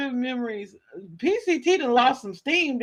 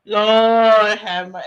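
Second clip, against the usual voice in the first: slow, louder Lord have mercy; drawn-out mock lament; 6 dB louder, much slower than usual. Streamer mourning a fallen internet figure, slow louder lament. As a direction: stretch it like a church moan. Mock grief over Ernest Shell.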